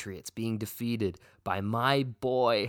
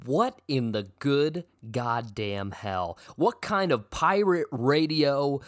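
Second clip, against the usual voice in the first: usual speaking voice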